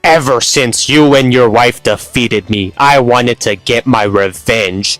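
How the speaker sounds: hammed-up evil villain speech; villain monologue. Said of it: "her little villain monologue".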